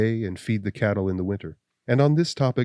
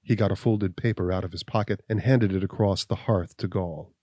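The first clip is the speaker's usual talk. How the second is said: ordinary delivery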